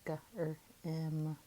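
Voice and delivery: "g, er, m" said as separate sounds; sounded out. Shown as a demonstration. Teacher sounding out a second syllable, "g, er, m" sound by sound. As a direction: break it into "g", "er", "m". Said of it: "Second syllable".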